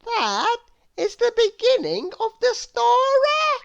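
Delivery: put-on very high little girl voice; Little Miss Contrary. A narrator's reading. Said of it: "as little Miss Contrary would say".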